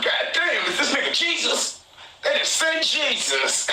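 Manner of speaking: high, laughing amazement; laughing disbelief. Retold or imitated. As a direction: laugh it out in awe. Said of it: "I said".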